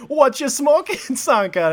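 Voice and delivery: high, loud put-on Jamaican accent; teasing question in a Jamaican accent. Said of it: "your Jamaican accent".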